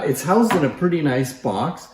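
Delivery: ordinary talk, nothing marked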